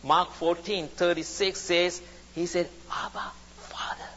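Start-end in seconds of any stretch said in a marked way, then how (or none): 2.9-4.1 hushed, reverent cry to the Father; hushed prayer. Retold or imitated. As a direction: say it very softly, barely voiced. Quoting Jesus praying in Gethsemane.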